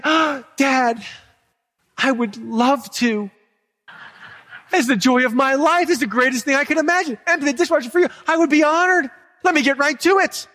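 put-on a child gushing at dad about emptying the dishwasher; overeager child. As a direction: ham up the delighted child, laughing through it. Dream child eager to do chores.

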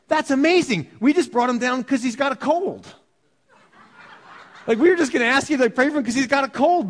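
high, loud astonished exclaim; amazed family. Retold or imitated. Family telling Pastor Chuck they only came about a cold, amazed. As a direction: gush in disbelief.

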